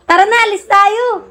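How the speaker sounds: high-pitched coaxing, falling at the end; high, lilting invite to go; coaxing invitation. Real, not acted. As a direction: coax them up in a high voice. Coaxing the sleepy one to go.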